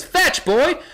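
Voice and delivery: high, lively call to a dog; mock dog-calling. Someone throws a stick at a werewolf, mock dog call.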